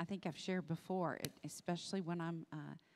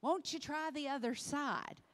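mock calling out; 9 semitones higher than usual. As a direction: imitate someone yelling a suggestion from afar. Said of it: "someone yells out from".

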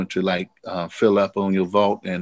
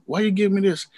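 surprised; 11 semitones higher, faster than usual. Retold or imitated. Himself, surprised, retold.

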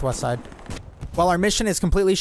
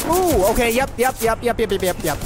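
excited; 7 semitones higher than usual. Real, not acted. Excited reaction in firefight.

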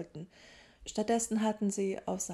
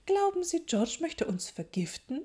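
put-on high-pitched older professor's voice, questioning; McGonagall; 9 semitones higher, 3 dB louder than usual. A narrator's reading. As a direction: start high, then lift the question at the end. Voiced professor questions her colleague in story.

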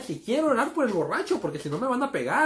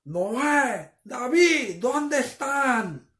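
mom calling out; 5 semitones higher, much slower than usual. Retold or imitated. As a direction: call the kids from downstairs. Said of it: "Nos gritó".

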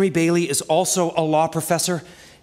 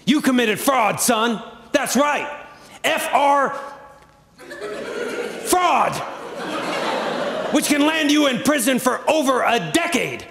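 mock threatening banker; 7 semitones higher, slower than usual. Banker threatens with fraud charge.

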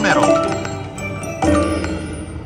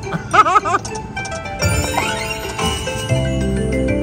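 high giggle; 3 dB quieter than usual. Real, not acted. Laughs at a small win.

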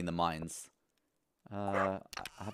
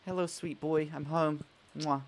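higher-pitched sweet cooing; affectionate pet greeting; 6 semitones higher than usual. Real, not acted.

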